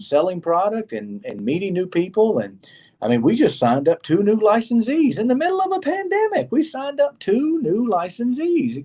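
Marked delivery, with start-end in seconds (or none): 3.2-6.5 excited. Real, not acted. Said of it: "Can you believe that?". 6.5-8.8 higher, slower, amazed repeat; "We" stressed high and slow in delight; excited repeat. Real, not acted. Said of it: "Can you believe that?".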